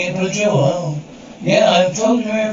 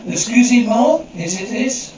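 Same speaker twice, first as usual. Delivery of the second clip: normal talking voice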